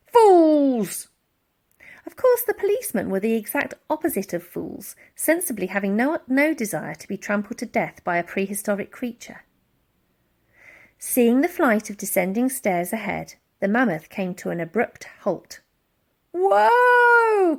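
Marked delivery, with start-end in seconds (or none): 0.1-1.1 high, loud scornful yell; angry shout. A narrator's reading. Barker shouting at fleeing men, angry yell. 16.3-17.6 high, loud whoa; startled cry. A narrator's reading. Elsie hurled through the air, startled cry. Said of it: "cried Elsie".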